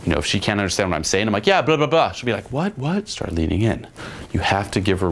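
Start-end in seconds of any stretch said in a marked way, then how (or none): 1.5-2.1 quick higher mock chatter; dismissive babble. Shown as a demonstration. Coach imitating his own chatter to a girl, breezy babble. Mimes babble she can't understand. 2.5-3.1 higher, rising puzzled questions; mock confused asking. Coach imitating a girl who can't hear, puzzled.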